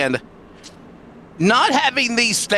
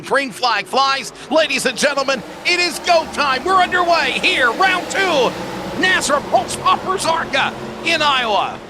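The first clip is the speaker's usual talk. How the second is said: excited race call; 8 semitones higher than usual. Real, not acted. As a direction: call the green flag with mounting excitement.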